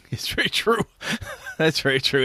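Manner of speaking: high, laughing through the words; amused, high-pitched agreement broken by laughs. Real, not acted. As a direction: agree while cracking up.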